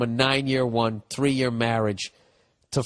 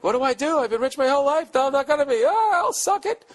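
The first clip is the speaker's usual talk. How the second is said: much higher, squeaky mock voice; mock panicked rich girl; 13 semitones higher, 3 dB louder than usual. Host mocking the rich girlfriend's panic, squeaky high voice. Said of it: "She's freaking out. Like".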